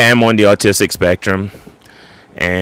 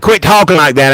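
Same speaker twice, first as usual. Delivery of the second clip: higher, imitated scolding mom; mad mom; 9 semitones higher, 4 dB louder than usual. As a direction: scold like a fed-up mother in a retold quarrel. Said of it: "my mom would get mad at me".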